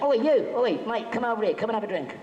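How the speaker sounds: mock Cockney yell at a passing stranger; Cockney shout. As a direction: hail him like a rowdy Londoner, fast at the end. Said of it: "they were all Londoners".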